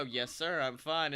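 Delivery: low, soft, composed retold reply; feigned sobriety. Drunk guest assuring a man in the bathroom, pretending sobriety. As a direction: answer quietly, as if perfectly sober. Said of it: "sober as a mule".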